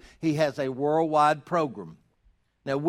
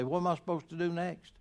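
quick, softer mock-helpless question; sarcastic and humorous; 8 dB quieter, faster than usual. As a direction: imitate someone meekly asking for orders. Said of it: "be really both sarcastic and humorous".

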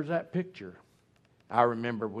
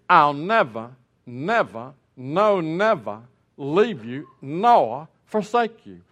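booming, slow preacher cadence, louder and higher; Billy Graham; 4 semitones higher, 10 dB louder, slower than usual. Retold or imitated. Preacher quoting Hebrews to the congregation, booming and slow. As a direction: stretch each never like a revival preacher. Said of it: "in the voice of Billy Graham".

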